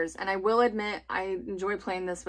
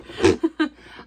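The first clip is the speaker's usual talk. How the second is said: bright laugh; 8 semitones higher, 10 dB louder than usual. Real, not acted. Laughing at her own joke.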